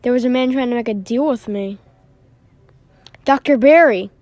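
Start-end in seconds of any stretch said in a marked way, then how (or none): 3.2-4.1 loud, higher accusing yell; furious loud accusation; angry shout. Acted. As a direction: hurl the insult. Confronting enemy.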